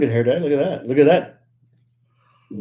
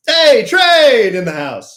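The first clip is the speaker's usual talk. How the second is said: loud slow call; 7 semitones higher, 6 dB louder, slower than usual. Real, not acted.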